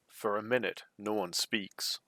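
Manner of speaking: usual speaking voice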